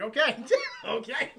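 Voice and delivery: high, slow laughing okays; giggling okay. Real, not acted. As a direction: dissolve into giggles. Giggling quote.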